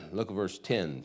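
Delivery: normal talking voice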